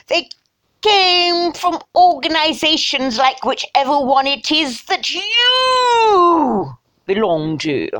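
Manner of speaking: hammed-up English toff, building to a long wail; mock upper-class English, overblown; snotty English. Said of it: "I think snotty English".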